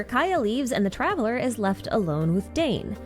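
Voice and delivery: ordinary talk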